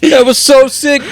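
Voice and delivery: high laughing bro shout; frat bro. Retold or imitated. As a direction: whoop it like a stoked bro.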